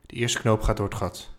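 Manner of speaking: ordinary delivery, nothing marked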